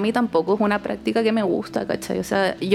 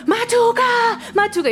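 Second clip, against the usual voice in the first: retold yelling; 14 semitones higher than usual. Heckling a guy at a party, imitated high yell. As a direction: call out high, reenacting the heckling. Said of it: "Y le gritaba".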